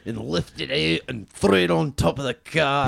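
mock Scottish correspondent; put-on Scottish accent. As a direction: keep reporting in broad Scottish. Plays Scottish news correspondent.

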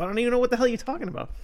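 put-on a teen caught out, talking fast and high; flustered denial. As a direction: blurt it out fast to dodge the question.

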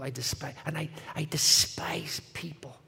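slow, breathy whispered confession; a slow, hushed admission. Retold or imitated. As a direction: let it slip out in a slow whisper. Confessing bitterness before breaking down.

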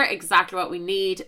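ordinary talk, nothing marked

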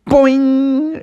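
high springy boing effect; high-pitched cartoon spring noise. A narrator's reading. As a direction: bounce it out like a spring. Sound effect as Bucky ducks.

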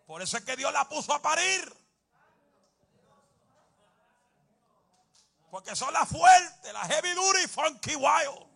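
high, loud shouted preaching; preacher hyping the strong women, loud and high; full-force preaching. Acted. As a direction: shout it to lift the church.

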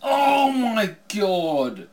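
very drawn-out high cry; big, slow exclamation falling away; mock commentator shock. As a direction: stretch it out like a stunned ringside announcer. Mock commentator reacting to big move.

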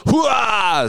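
high imitation of a startled waking cry; startled whoop. Husband imitating Becky jolted wide awake, startled whoop. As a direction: let out a high mock whoop. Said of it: "She's wide awake".

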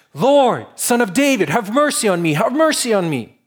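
desperate plea. Acted. Woman crying out to Jesus.

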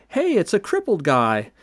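high-pitched imitation of an excited movie character; mock gleeful call. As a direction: call it out high, with playful glee. Movie scene acted, gleeful calling out.

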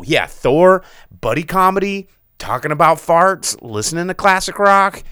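imitated loud enthusiasm; mock eager audience. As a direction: cheer for it like an easily pleased fan. Acts an audience cheering silly content.

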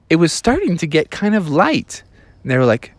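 ordinary delivery, nothing marked

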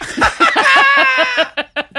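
high, loud cackle; helpless high-pitched laughing; real burst of laughter. Real, not acted. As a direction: crack up at your own story. Laughing at the retold line.

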